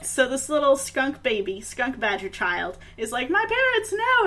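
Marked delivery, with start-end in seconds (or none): 3.3-4.3 very high imitated child's cry; mock distress. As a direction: cry out as a panicked little skunk child. Voicing orphaned skunk child's cry.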